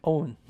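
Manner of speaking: "on" mispronounced as a rounded "own"; wrong vowel. Shown as a demonstration. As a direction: show the awkward closed-O "on". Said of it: "약간 이런 느낌이에요". ("on"을 '오운'처럼 어색하게 발음)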